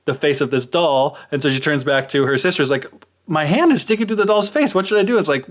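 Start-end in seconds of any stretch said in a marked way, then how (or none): 3.3-5.2 higher-pitched, lightly hammed-up dismay; mock alarm. Stuck fairy asks her sister for help, in mock alarm. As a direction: play a flustered fairy pleading for help.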